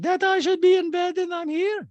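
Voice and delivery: very high, loud mock questioning; Diana impersonation. Host mimics housewife questioned on bed rest, high loud voice. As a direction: ask it in a piercing caricature. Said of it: "And Diana's like".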